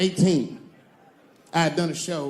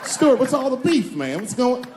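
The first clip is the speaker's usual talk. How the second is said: higher, louder forced cheer; mock loud greeting; 7 semitones higher, 3 dB louder than usual. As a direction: greet him over-loud. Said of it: "greeting Stuart loudly".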